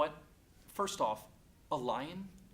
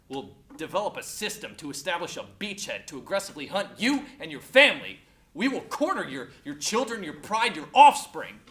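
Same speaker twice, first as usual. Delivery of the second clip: building, louder threats; menacing rant; 2 semitones higher, 8 dB louder than usual. Acted. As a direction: hammer out the threat, louder on key words. Mock threat in acted rant.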